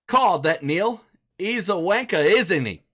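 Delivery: high-pitched mock British accent; bad British accent. Shown as a demonstration. Host reading a viewer's dare about Neil, bad British accent. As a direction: attempt British, landing closer to Australian. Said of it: "say it in a British accent".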